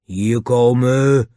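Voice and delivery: slow, drawn-out menacing order; threatening. A narrator's reading. Lou orders the child over to him, threatening. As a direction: stretch the command out like a threat. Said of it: "zei Lou dreigend".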